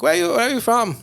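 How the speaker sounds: high, broken-English Thai cabbie question; Thai cab driver accent. Retold or imitated. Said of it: "very broken English".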